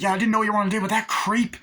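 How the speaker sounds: high, loud, fast angry protest; mock outrage. Brother yelling at his sister, high, loud and fast. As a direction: spit it out indignantly.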